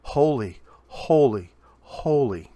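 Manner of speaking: each "holy" set apart and punched; measured, ringing proclamation with pauses between words; angels crying out. Retold or imitated. Preacher quoting angels around the throne, crying out. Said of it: "angels around the throne cry out".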